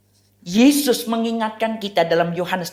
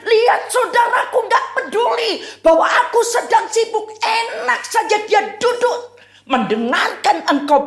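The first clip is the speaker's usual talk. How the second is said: high, loud whiny complaint; indignant, high-pitched protest; complaining Martha; 11 semitones higher, 4 dB louder than usual. Retold or imitated. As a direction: Martha griping to Jesus about her sister. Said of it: "Marta mulai marah".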